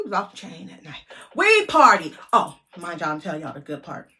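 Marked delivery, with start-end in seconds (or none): 1.4-2.6 loud, high burst; excited. Real, not acted. Hyping up the party night to viewers, excited shout. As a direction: shout it out with glee.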